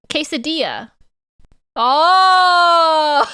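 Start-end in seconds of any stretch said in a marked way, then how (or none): 1.8-3.3 long, high, loud oh breaking into laughing; real outburst of laughter as it dawns; realisation into laughter. Real, not acted. Streamer realising she says quesadilla properly, real laughing outburst.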